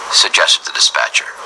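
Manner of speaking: ordinary delivery, nothing marked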